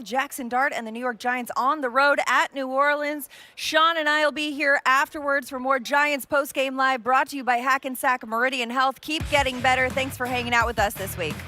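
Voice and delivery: usual speaking voice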